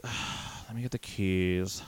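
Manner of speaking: voiceless "Ugh," then grudging, lightly changed voice; mock the clerk's put-upon reluctance; annoyed clerk. Clerk fetching keys for an impatient customer, mildly annoyed. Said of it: "very, uh, very, uh, annoyed".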